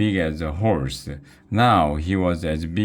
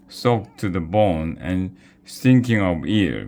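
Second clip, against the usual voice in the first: ordinary delivery, nothing marked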